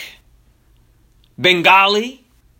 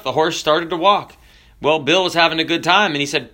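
ordinary talk, nothing marked

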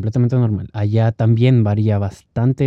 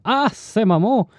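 bad Mexican imitation; 12 semitones higher than usual. A foreigner imitating Mexicans badly, in a high hammed-up accent. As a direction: ham up a clumsy Mexican impression. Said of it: "no soy muy bueno".

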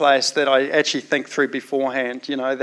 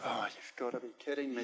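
very soft groaning disbelief; barely voiced, weary aside; exasperated mutter; 4 semitones lower, 22 dB quieter than usual. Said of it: "It's like".

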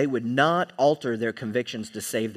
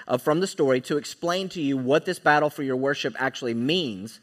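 normal talking voice

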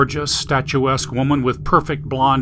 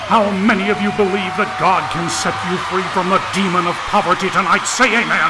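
raised, fast revival preaching; booming preacher; 5 semitones higher than usual. A narrator's reading. Preacher rousing thousands over a speaker system, booming. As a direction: work the crowd like a revival preacher. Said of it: "a man's powerful voice boomed out".

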